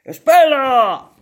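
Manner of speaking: loud slow shout; shouted wait. Acted. Prince shouts to the drowning princess, loud. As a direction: call out to stop her. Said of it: "gritó a la princesa".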